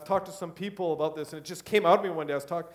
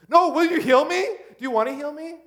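much higher, louder begging question; pleading louder; 10 semitones higher, 8 dB louder than usual. Retold or imitated. Preacher acting a husband begging his wife for healing. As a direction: whine it like a desperate plea. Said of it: "begging harder".

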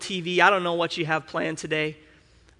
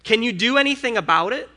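higher, louder challenging question; God questioning him; 6 semitones higher, 5 dB louder than usual. Retold or imitated. God questioning the worried preacher, raised pointed voice. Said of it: "He's like".

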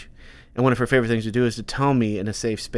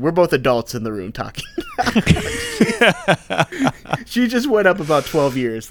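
ordinary delivery

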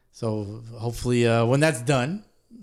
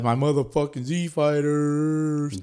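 slow, higher, drawn-out hype; hyped shout out; 4 semitones higher, 3 dB louder, slower than usual. Real, not acted. Podcast host hyping his team, slow and stretched.

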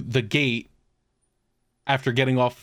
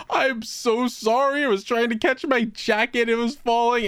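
flustered apology; 9 semitones higher than usual. Retold or imitated. Apologizing after hitting a man.

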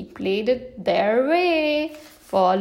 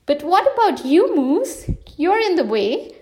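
higher, louder exasperated question; annoyed protest; 4 semitones higher, 4 dB louder than usual. Acted. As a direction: push back at the boss. Friends protest, loud and high.

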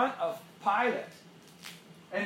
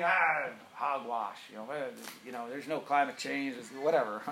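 dismissive skeptic; 5 semitones lower, 3 dB quieter than usual. Retold or imitated. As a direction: brush it all off like a grumpy skeptic. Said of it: "Not that you'd ever talk like that".

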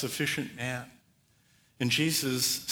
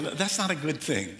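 normal talking voice

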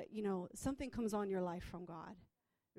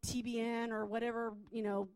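ordinary talk, nothing marked

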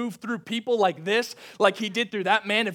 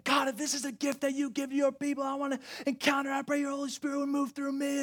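inner screamed prayer; 8 semitones higher, 4 dB quieter than usual. Retold or imitated. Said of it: "I remember screaming the prayers".

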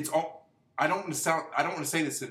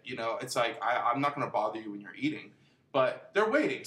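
normal talking voice

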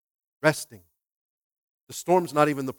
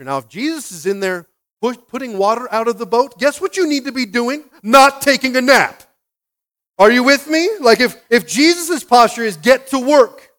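loud, raised-pitch exhortation; full-force preaching; 8 semitones higher, 14 dB louder than usual. Real, not acted. Preacher urging the congregation to get to work, forceful shout. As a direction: drive it home to the congregation, very loud.

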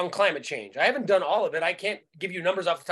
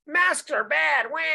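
high, slow whining mockery; mocking whiny voice; 6 semitones higher, slower than usual. Mocking Bernie bros as whiny babies. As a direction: whine it like a crybaby. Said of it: "The Bernie bro type people are like".

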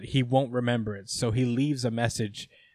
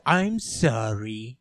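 slow, drawn-out mock sorry; air-quote apology; much slower than usual. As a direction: stretch out an insincere apology. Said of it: "air quotes".

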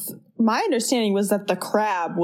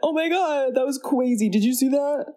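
higher-pitched astonished exclaiming; mock amazement; 5 semitones higher than usual. Retelling both of them seeing the peel float, mock amazed. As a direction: act out a stunned reaction. Acting both characters' shock at levitation.

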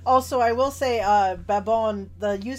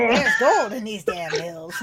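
put-on louder old gold miner voice; old prospector; 5 dB louder than usual. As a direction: ham up a crusty prospector. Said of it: "that really old grizzled prospector".

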